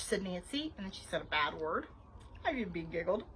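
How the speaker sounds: usual speaking voice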